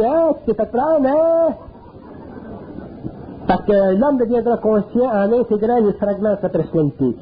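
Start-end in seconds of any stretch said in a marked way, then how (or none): 0.0-1.5 high mocking taunt of the little voice; little inner voice. The little inner voice mocking a scared man, high taunting. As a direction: taunt him like a sneering inner voice. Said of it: "la petite voix qui arrive, elle dit".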